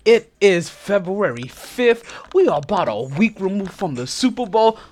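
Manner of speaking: loud hyped show opener; podcast host intro. Acted. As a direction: announce it big to the listeners.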